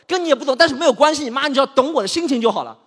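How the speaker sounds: fast, loud, high plea; exasperated. Acted. Begging mom to just get his mood, fast and loud. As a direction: rush through it, desperate to be heard.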